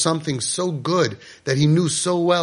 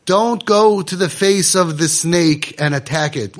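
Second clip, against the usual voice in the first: normal talking voice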